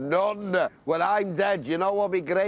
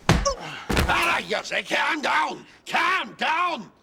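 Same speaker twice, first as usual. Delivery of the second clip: higher shouted calm down; catchphrase shout; 7 semitones higher, slower than usual. Acted. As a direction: bellow the catchphrase.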